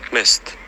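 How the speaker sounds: mist with Ö in place of i; mist, vowel shifted from i toward Ö; village Ö vowel. Shown as a demonstration. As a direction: say mist with a rounded Ö sound.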